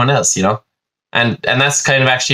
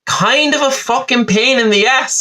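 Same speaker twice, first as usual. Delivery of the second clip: exaggerated whine; 12 semitones higher, slower than usual. Exaggerated rant about existing.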